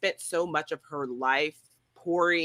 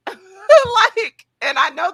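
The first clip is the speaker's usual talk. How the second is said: laughing out loud, much higher and louder; real laugh; 10 dB louder, much slower than usual. Real, not acted. Laughing at her own cheeky remark about the actor. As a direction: burst out laughing mid-word.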